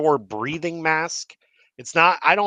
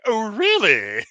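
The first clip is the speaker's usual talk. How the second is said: high, teasing "oh really"; mock surprised; 10 semitones higher, 6 dB louder, slower than usual. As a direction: act playfully intrigued. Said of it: "a group in the Star Wars fandom that's like".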